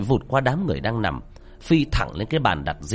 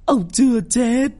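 much higher, louder, slow sobbing wail; wailing cry; 13 semitones higher, 5 dB louder than usual. A narrator's reading. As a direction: widow crying to her husband. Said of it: "miệng gào khóc". (gào khóc thảm thiết)

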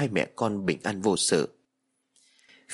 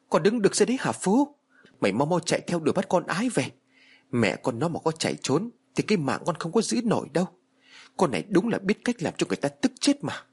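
angry yell; 6 semitones higher than usual. A narrator's reading. Mother yelling at her frozen son, angry yell. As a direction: yell at him to move. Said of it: "tức giận quát".

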